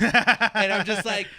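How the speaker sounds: high, loud burst of laughter through the words; laughing hard. Real, not acted. Laughing at the wrestling clip while telling it. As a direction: crack up mid-sentence.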